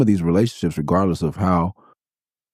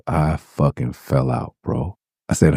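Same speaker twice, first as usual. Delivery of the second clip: ordinary delivery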